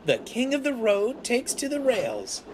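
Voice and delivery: very high, gleeful character voice; chuckling tease. A narrator's reading. Percy teases the stranded steamroller, chuckling. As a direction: crow mockingly. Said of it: "he chuckled".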